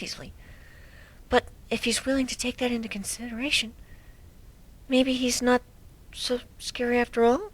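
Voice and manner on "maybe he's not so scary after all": ordinary delivery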